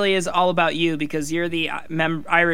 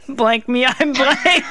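high voice breaking into laughter; cracking up while announcing it; laughing; 6 semitones higher, 3 dB louder, much slower than usual. Real, not acted. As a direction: barely get it out through the giggles. Announcing draft name through laughter.

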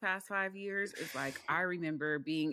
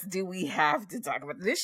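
usual speaking voice

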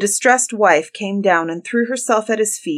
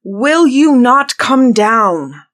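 very slow, loud, higher demand; angry shout; 5 semitones higher, 9 dB louder, much slower than usual. A narrator's reading. Bluebeard demanding that his wife descend, angry shout. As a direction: bellow each word, stretched out. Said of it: "cried Bluebeard".

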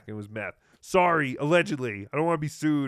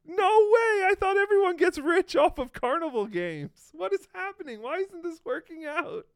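very high, hammed-up shocked wail; feigned heartbreak in a squeaky high voice; mock disbelief; 14 semitones higher, 5 dB louder than usual. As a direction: start loud, ease into high mock bewilderment. Mock shock at streamer's gambling losses.

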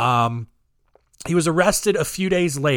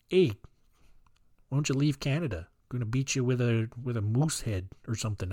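mock Canadian; 5 semitones lower, 8 dB quieter than usual. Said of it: "the Jespersons were like".

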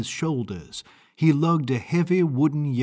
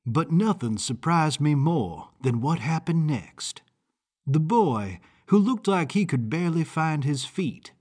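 ordinary delivery

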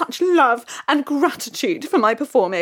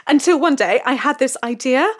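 ordinary talk

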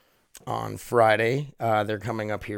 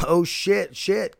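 higher, louder startled oh shit; mock alarmed; 7 semitones higher, 4 dB louder, much slower than usual. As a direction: yelp it in fake alarm.

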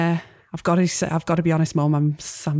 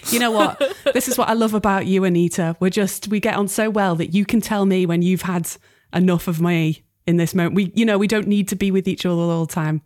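a lightly voiced pushy Manchester neighbour; pushy, in a Manchester accent. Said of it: "Trying to do a Manchester accent".

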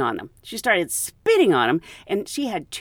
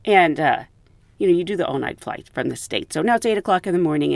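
ordinary talk, nothing marked

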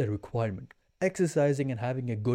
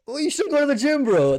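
mock advice; 13 semitones higher, 7 dB louder than usual. Mocking others' easy advice.

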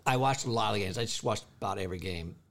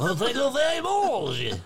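high, slow hammed-up French accent; mock French accent; 13 semitones higher, 4 dB louder, slower than usual. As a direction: answer in an exaggerated French accent. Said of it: "That was a great accent".

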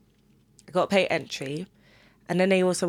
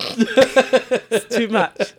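dramatic whine; 4 semitones higher, 6 dB louder, much slower than usual. Acted. Mock dramatic complaint.

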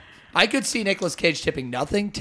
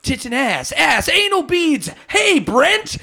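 very high, loud manic movie-star impression; Nicolas Cage; 12 semitones higher, 5 dB louder, slower than usual. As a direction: yelp it out in a frantic, drawn-out high voice. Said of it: "I can do that one".

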